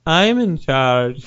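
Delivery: slow, drawn-out imitation of Tommy Wiseau; mock Tommy Wiseau. Tommy Wiseau claiming charge in a slow, drawn-out impression. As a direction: say it slowly, like an odd self-important star. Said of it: "And Tommy was like".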